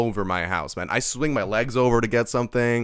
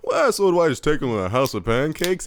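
playing it cool; 4 semitones higher, 4 dB louder than usual. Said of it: "He's, like, trying to play it off cool".

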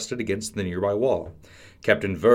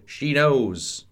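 higher dramatic exclamation; acted cry of dread; 5 semitones higher, 4 dB louder than usual. A narrator's reading. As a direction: blurt the alarming realisation. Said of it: "Prince Colin cried".

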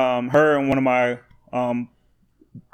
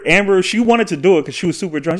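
ordinary delivery

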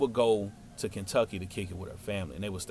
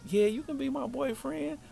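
put-on high, girlish acceptance; Felicia's voice; 14 semitones higher, 5 dB quieter than usual. Girl telling the boy he can be her boyfriend, high and girlish. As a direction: say yes in a high little-girl voice.

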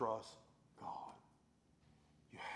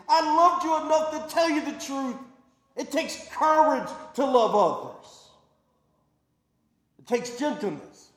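loud, high, fervent declaration of Paul's words; full-force preaching as Paul; 11 semitones higher, 14 dB louder than usual. Acted. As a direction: preach Paul's courage to the crowd.

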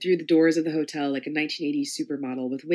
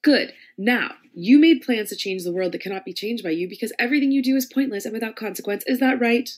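louder, mocking mistress; taunting domme; 5 semitones higher, 4 dB louder than usual. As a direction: belittle him with relish. Lady M taunts Hamlet.

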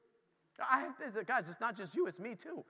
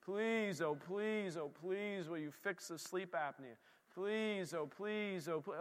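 reenacting own begging; 4 semitones lower, 11 dB quieter, slower than usual. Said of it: "I'm, I'm reenacting me".

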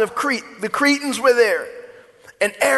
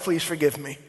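normal talking voice

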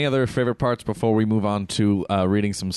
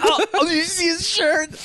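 high-pitched delighted burst of surprise, laughing; giddy squeal at spotting something; happy and surprised. Retold or imitated. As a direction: re-enact a thrilled discovery in a much higher voice. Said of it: "like, really happy and surprised".

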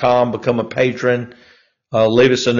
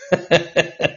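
quick amused chuckle; a light burst of laughter; short laugh; 5 semitones higher, 4 dB quieter than usual. Real, not acted. Laughs alone before the tweet.

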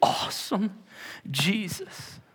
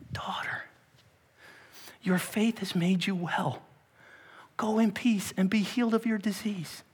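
tender reassurance; 3 semitones higher, 6 dB quieter than usual. Acted. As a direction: comfort her like a daughter. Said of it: "calls her daughter".

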